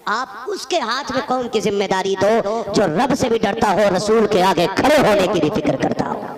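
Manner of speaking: loud sermon voice; fiery preaching. Acted. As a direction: preach it out to the crowd. Preaching at full force.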